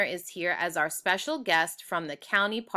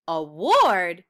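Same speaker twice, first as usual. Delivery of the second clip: surprised; 8 dB louder than usual. Acted. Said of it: "Judy stood up straighter".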